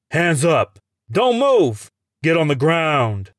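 high, loud barked orders; shouted commands. Acted. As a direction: yell orders at the suspect. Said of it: "the men surround me and shout".

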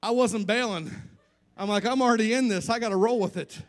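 high, loud and gleeful; loud, laughing excitement; excited. Real, not acted. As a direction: burst out giddy about the prank. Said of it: "laughing retelling of the prank call".

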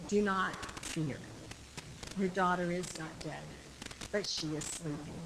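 low, soft, measured comfort; quietly reassure, pausing between phrases; calm reassurance. Retold or imitated. Jesus to Jairus about his daughter, calm and reassuring.

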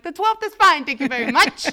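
high, loud, gushing yes; overeager agreement. Retold or imitated. Caller gladly accepting the new delivery date. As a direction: jump at the offer, gushing.

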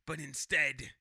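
put-on sailor voice trailing into a whisper; Quint. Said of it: "you keep talking like that".

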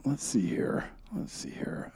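whispering to herself while thinking. Real, not acted. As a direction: mutter it under your breath, unvoiced.

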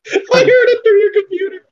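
high squealing laugh while talking; laughing shriek. Real, not acted. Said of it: "It's like".

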